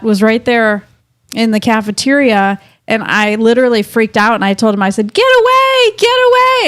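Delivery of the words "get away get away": retold panicked cry. Woman retelling freaking out at her mascot, high panicked cry. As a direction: pitch leaps up, words stretched in imitated panic.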